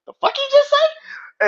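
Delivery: very high, loud disbelieving yelp; stunned outrage. Retold or imitated. Roman hearing Jey defy him, shocked and loud. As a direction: squawk it in total disbelief. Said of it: "Roman just being like".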